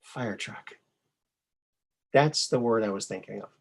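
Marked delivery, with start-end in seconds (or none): 0.0-0.7 soft, deadpan repeat of Fire truck; quiet repeat. Acted. Repeating the dad joke punchline, quietly deadpan. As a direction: say it again, dry and soft.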